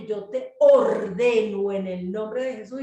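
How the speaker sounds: loud, slow, weighty order; re-enacted command, every word driven home; commanding a demon. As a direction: order it out with full authority. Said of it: "yo le dije al demonio".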